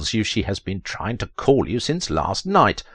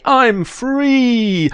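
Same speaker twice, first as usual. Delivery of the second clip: very high, louder cry of freedom; hammed-up joy; 5 dB louder than usual. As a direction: shriek it out like a freed prisoner. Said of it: "You say".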